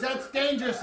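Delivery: high, loud mock yell of alarm. Old doctor stopping the patient from another spray, yelling. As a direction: yell no no like you're stopping him.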